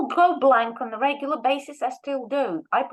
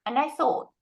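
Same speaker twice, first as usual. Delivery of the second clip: normal talking voice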